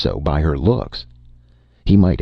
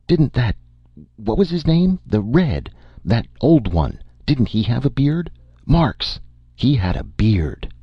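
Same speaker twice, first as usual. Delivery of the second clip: higher-pitched, lightly voiced suspicious woman; agitated suspicion; 8 semitones higher than usual. A narrator's reading. Shopper recalling a bearded Red to the storekeeper, agitated. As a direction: grope for the name with rising alarm.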